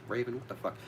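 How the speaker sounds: normal talking voice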